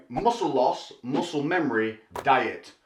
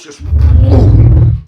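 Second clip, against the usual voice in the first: explosive boom; 17 dB louder than usual. Pretend. Sound effect for muscle pulse.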